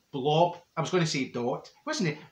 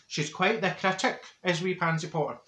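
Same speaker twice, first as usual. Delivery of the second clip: normal talking voice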